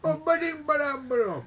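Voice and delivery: high, brash New Jersey voice; Meryl Streep impression. Friend mocking Meryl Streep's New Jersey accent, high brash voice. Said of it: "my Meryl Streep impression".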